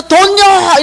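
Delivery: high, loud retold cry; inner shout. Her heart answers the dream visitors, high shout. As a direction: yell it from inside the heart. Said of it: "이렇게 외치는데".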